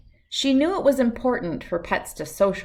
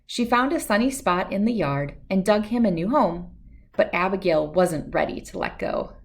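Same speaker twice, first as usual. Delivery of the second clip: ordinary delivery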